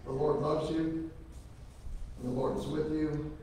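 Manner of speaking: usual speaking voice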